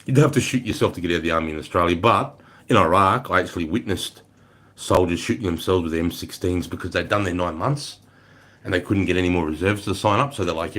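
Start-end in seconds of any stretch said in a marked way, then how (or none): none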